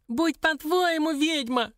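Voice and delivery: very high, slow squeaky yell; high-pitched exasperated shriek; dwarf's yell. A narrator's reading. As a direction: cry out surrender like a cornered imp. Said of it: "заорал он".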